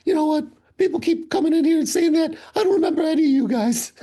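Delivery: high squeaky grumbling voice; Hammer's grumpy voice. Retold or imitated. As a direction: snap back like a cranky old barman. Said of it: "He's like".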